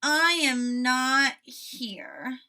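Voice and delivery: slow, loud grumble; mock-stubborn denial; grumpy sleepy Toad. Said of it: "said the voice".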